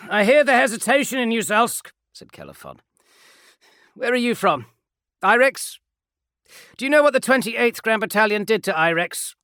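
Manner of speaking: high, loud impassioned plea; rally your brothers to mercy; impassioned marine. Acted. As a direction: Kelefon pleads for mercy, loud and passionate. Kelefon challenges sergeant.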